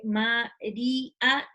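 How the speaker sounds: "María" drawn out very slowly, Ma-rí-a; slow syllabified. Shown as a demonstration. Teacher slowly shows the hiatus in a word. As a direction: stretch out "María" so the í and the a split. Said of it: "tengo la I y la A".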